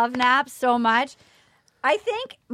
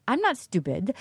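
put-on Russian accent; 6 semitones lower, 5 dB quieter than usual. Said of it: "Here comes my Russian accent".

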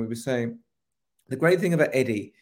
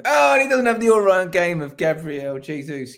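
a hint of sniffy caveat, raised a little; mocking imitation; 5 semitones higher, 5 dB louder than usual. Teasing his co-host about the Jesus caveat, mild mock voice. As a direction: lightly parody the co-host's grudging aside. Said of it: "you had to sort of slip in".

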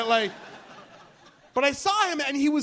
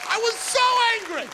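still fuming; 11 semitones higher, 4 dB louder than usual. Real, not acted. Repeated line, slower.

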